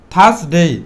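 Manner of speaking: থার্সডে without any r, "thas-dei"; silent r. Shown as a demonstration. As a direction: drop the r of থার্সডে, open "ah" and long end. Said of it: "আরটা silent থাকবে". (থার্সডে-র "র" নীরব, "থাসডেই")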